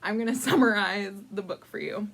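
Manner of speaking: usual speaking voice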